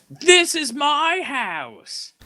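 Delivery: high, slow whiny mock yell; mocking Paige's whiny catchphrase, high and slow; mimicking Paige. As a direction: whine it like a petulant child. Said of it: "the only thing I've ever heard her say".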